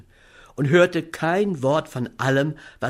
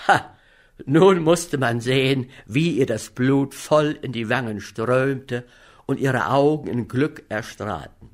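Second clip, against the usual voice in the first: ordinary delivery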